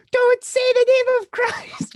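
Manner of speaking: high, slow, far-off shriek of panic; hammed-up high panicked cry; mock shriek. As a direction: squeal it as you run. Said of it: "just be like".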